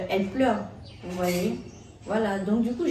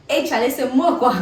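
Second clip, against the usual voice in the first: retold shout; 5 semitones higher, 10 dB louder than usual.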